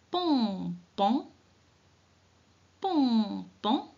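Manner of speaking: pompon sounded out slowly with a rising end; slow sounding-out demo; syllable by syllable. Shown as a demonstration. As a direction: decode pompon for little readers. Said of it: "Allez, avec moi".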